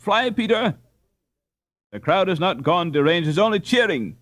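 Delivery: ordinary delivery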